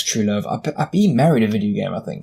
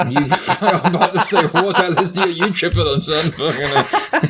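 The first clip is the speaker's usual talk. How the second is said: real incredulous laughter through the words, higher pitched; laughing; 6 semitones higher than usual. Real, not acted. Host teasing co-host who says he sees sounds, laughing. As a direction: crack up while you speak.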